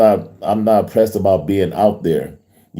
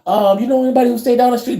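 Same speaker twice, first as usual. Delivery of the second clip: high, fast imitation of the visitor; 14 semitones higher than usual. Visitor at the door, retold.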